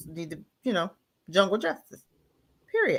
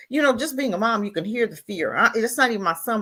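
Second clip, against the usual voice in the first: ordinary talk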